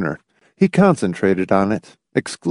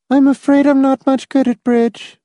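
high, thin-toned reply without colour; colorless voice; 4 dB louder than usual. A narrator's reading. Reluctant guest answering the hostess, colorless. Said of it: "colorless voice answered".

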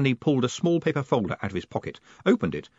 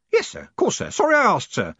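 put-on very high, louder old man; old Grote, apologetic; 11 semitones higher, 5 dB louder than usual. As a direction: apologise hastily to the boss. Grote hastily apologises when scolded.

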